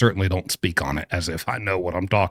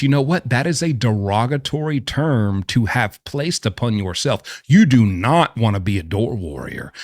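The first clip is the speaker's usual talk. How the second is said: normal talking voice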